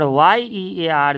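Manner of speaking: वाई ई ए आर letter by letter, drawn out; slow spelling out. Shown as a demonstration. Teacher contrasting year with ear, वाई ई ए आर spelled slowly.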